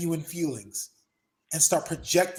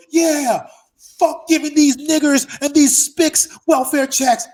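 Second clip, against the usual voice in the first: much higher, louder, slow hateful yell; 11 semitones higher, 12 dB louder, slower than usual. Retold or imitated. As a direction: sneer it like a bigot at a rally. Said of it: "the guy next to him goes".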